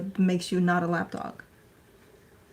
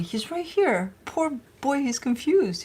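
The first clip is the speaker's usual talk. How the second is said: normal talking voice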